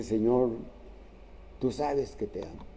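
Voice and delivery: sad, quiet confession, imitated without sobbing; subdued mock tearfulness. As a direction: retell a tearful answer, hushed and low. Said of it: "Y Pedro llora y le dice".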